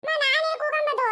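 put-on very high, drawn-out kid voice; whining child. Child insists to mother on a seat, whining child voice. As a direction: insist slowly like a small child.

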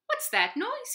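high, eager little puppy; puppy's voice. A narrator's reading. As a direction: pitch way up like a small excited dog. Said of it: "he said".

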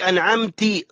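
أنعمتي ending in "tee"; wrong ending. Retold or imitated. Teacher mimics prayer-voiding slip, i ending on أنعمتي. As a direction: finish أنعمتي on an i vowel. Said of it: "صلاة باطلة".

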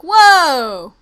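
loud falling whoa; real loud whoa; startled shout. Real, not acted. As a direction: jolt of surprise. Sudden lag shock.

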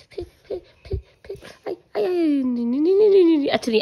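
high squeals of pretend pain; hammed-up no no no; mock squealing. Mock pain playing with toddler. (बच्चे के साथ नकली चीखें)

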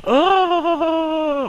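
very high breathy "oh"; impressed gasp. Retold or imitated. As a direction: swoon at the shot. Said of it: "You would have been going".

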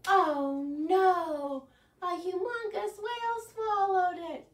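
high, loud mock-alarmed oh no; dramatic oh no. Shown as a demonstration. Librarian acts shocked for children in the song, high and loud. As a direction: gasp it out in playful alarm for the kids. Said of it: "the part where we go, "Oh no".

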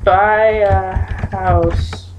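normal talking voice

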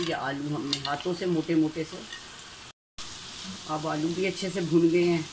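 ordinary delivery

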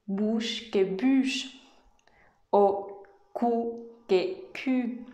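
"bouche" with ou, "bûche" with rounded u, spoken very slowly; slow contrast of "cou" and "cul"; French minimal pairs. Shown as a demonstration. Teacher slowly contrasting French OU and U for learners. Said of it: "no es lo mismo decir".

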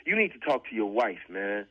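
usual speaking voice

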